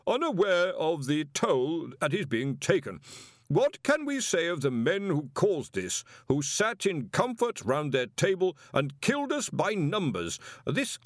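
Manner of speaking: orator's rising anger; impassioned denunciation. Acted. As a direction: stir the crowd against them. Omniscope speaker denouncing the board.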